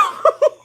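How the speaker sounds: high squealing laugh, real; burst of laughter. Real, not acted. Telling friends about dad, bursting into a laugh. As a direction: crack up at the memory.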